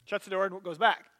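ordinary talk

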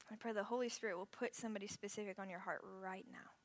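hushed reflective prayer; guided prayer. Acted. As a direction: guide them gently into prayer.

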